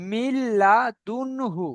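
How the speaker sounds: "মিল্লাদুনহু" very slowly, with a held double l; demonstrated pronunciation. Shown as a demonstration. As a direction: draw out "মিল্লাদুনহু" syllable by syllable. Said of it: "এটা আমরা উচ্চারণ করবো".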